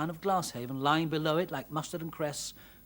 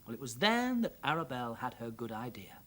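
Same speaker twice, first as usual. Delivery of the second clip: ordinary delivery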